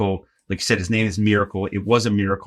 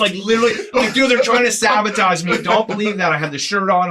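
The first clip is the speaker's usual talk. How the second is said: high and louder, urgently insisting; frantic pleading; 9 semitones higher, 4 dB louder than usual. Retold or imitated. Greg begging the Zamboni guy to ignore the girls, high and frantic. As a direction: rush it out, begging him not to believe them.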